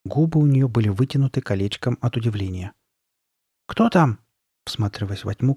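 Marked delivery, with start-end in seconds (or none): 3.7-4.2 higher-pitched wary call. A narrator's reading. Sabrina peering into the dark, calling out. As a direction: call out the question, pitch raised. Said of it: "крикнула она".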